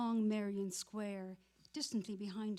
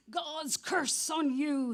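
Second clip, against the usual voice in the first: slow, bitter curse, higher and louder; sour curse; 6 semitones higher, 8 dB louder, slower than usual. Acted. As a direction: spit the curse out sourly. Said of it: "he said sourly".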